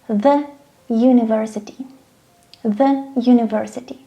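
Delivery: THE said "thuh" before university. Shown as a demonstration. Teacher demonstrating an exception, THE as "thuh". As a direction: say "thuh" for THE, university opening on a Y sound. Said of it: "we are pronouncing articles the".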